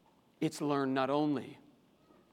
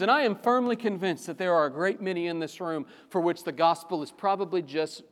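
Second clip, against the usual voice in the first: ordinary talk, nothing marked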